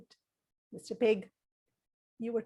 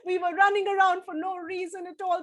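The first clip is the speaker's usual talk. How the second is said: laughing through the words; 5 semitones higher than usual. Acted. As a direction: laugh it out, giggly animals. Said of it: "they all started laughing".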